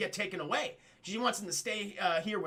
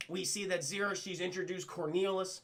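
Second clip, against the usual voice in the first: ordinary talk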